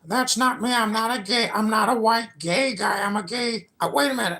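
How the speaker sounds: high, far-off squeaky voice; high-pitched voice. Real, not acted. Denies being the other voice.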